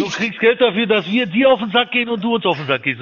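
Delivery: high, loud indignant outburst; angry shout. Real, not acted. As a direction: yell it back in outrage. Heated argument with caller.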